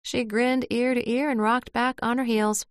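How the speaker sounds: plain reading voice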